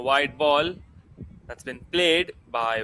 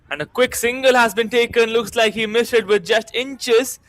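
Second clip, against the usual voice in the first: excited commentary; 6 semitones higher, 5 dB louder than usual. Real, not acted. Quick single in live commentary.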